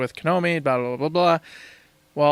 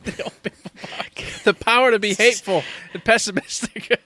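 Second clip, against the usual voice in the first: laughing; 5 semitones higher than usual. Real, not acted. Laughing at co-host's wild idea.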